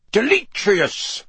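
high, stretched command; shouted spell. A narrator's reading. Mister Diggory banishes the smoky skull, shouted incantation. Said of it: "Mister Diggory shouted".

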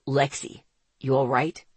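low-pitched male character voice; low, concerned question; concerned man. A narrator's reading. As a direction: drop low to check on someone. Zeke checks on shaken narrator.

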